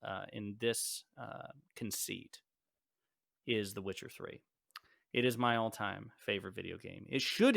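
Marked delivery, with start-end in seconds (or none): none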